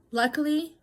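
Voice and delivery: Luckily punched high and loud; retold pointed remark. Wife to estranged husband, a pointed loud Luckily. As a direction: land Luckily hard and high. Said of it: "I said".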